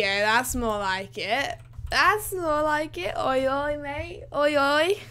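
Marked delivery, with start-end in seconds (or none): 1.9-3.1 pitched up high and slow, pleased; higher, pleased. Real, not acted. Repeats the line after good frame rate. 3.2-5.0 higher, mock laddish call; lightly put-on geezer shout-out; cheeky oi oi mate. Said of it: "I keep on saying oi, oi, mate".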